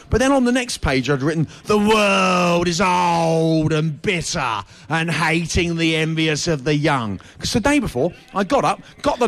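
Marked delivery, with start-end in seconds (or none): 1.6-7.2 slow, bitter reading. Shown as a demonstration. Comic reading his notebook to the radio hosts, slow and bitter. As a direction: read it slowly, like a sour old diary line.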